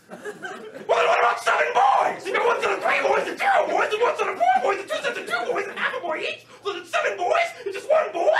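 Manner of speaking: hammed-up furious ranting at grandpa's math; exasperated rant. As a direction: spiral into outraged disbelief.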